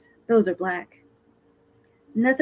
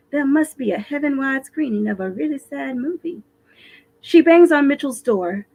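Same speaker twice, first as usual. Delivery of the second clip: ordinary delivery, nothing marked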